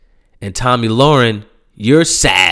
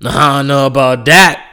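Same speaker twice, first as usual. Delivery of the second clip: mock the boast like an unimpressed auntie in the crowd; imagined women in the audience yelling back at the speaker; 3 semitones higher, 5 dB louder than usual.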